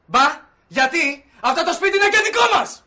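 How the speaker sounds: very high, loud protest; indignant yelling. Acted. Evicted housemate claiming the house is theirs too, yelling.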